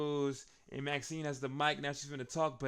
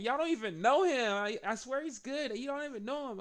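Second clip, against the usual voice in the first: high-pitched Maxine pleading; 9 semitones higher than usual. Retold or imitated. Recapper voicing Maxine, high and pleading.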